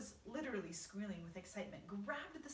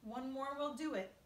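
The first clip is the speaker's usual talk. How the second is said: raised, encouraging call; shouting; 5 semitones higher, 5 dB louder than usual. A narrator's reading. Old green grasshopper urging one more seagull, shouting. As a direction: call it out high and loud. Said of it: "shouted the old green grasshopper".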